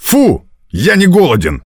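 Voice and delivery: high, disgusted outburst; disgusted refusal. A narrator's reading. Spits out rotten apple.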